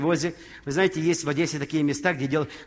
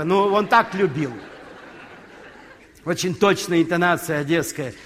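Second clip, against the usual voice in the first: normal talking voice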